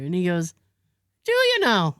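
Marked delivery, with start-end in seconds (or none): 1.2-2.0 high, skeptical "Do you now?"; quoting Scottish guy. Retold or imitated. Wi-Fi guy doubting she lives here, pitched high. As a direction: lift it up, doubting.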